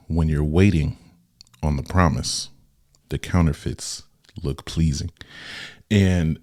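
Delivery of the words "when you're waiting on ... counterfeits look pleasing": slow reading, pausing after each phrase; real slow. Shown as a demonstration. Host rereading the line to listeners, real slow. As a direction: break it into slow, separate phrases for emphasis. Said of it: "I'm gonna read it again real slow".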